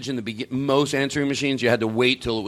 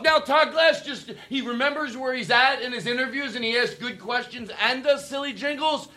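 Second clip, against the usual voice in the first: high, laughing mock gossip; giggling through the fake rumor; mock jealous gossip, laughing; 11 semitones higher than usual. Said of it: "they go".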